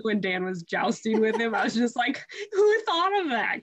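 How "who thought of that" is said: incredulous. Retold or imitated. Retold disbelief at funny scene.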